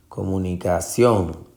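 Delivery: comunicación stressed properly on CIÓN; stress on last syllable. Shown as a demonstration. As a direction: save the force for the final ción of comunicación. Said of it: "Es sion la sílaba tónica". (comunicación con fuerza en la última sílaba, CIÓN)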